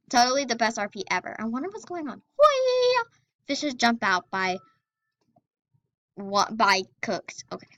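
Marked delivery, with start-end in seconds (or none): 2.4-3.0 high-pitched, drawn-out "whee"; high squeal. Real, not acted. Player cheering during the game, high squeal. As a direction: let out a high playful squeal.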